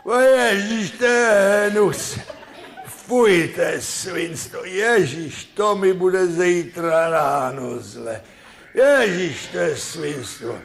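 put-on high, loud, slow whining drunk, half laughing; drunk lament. Second drunk regrets downing the brew, high slow whine.

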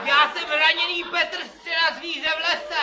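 shouted. Pretend. Injured man shouts his name.

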